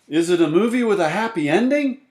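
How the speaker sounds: loud, high, anxious question; high-pitched insistent question; child character's voice. A narrator's reading. Frank asks excitedly in story.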